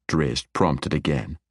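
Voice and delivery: usual speaking voice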